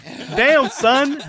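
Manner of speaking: loud, high-pitched echo of an over-the-top reaction; hammed-up disbelief, pitched high and loud; mock astonished exclamation. Host echoes the clip's mock reaction.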